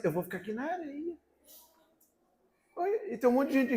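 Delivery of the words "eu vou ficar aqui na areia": sister's reply. Retold or imitated.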